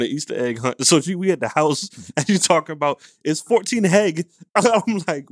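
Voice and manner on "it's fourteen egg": mocking sister. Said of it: "I like making fun of my sister".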